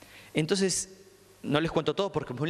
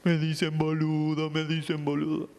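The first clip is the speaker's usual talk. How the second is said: put-on distraught boy. Boy telling the therapist he's called boludo, distraught. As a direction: let it sound like the insult still stings. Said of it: "Doce años tiene".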